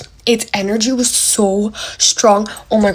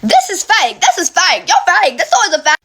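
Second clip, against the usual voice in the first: high, whiny heckler chant; mocking commenters; 6 semitones higher than usual. Storyteller imitating doubters in her comments, mocking. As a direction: mimic naysayers in a raised pitch. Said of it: "to everyone who's gonna be in my comments".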